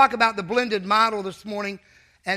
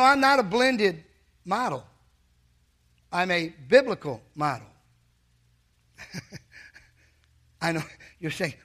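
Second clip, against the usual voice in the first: usual speaking voice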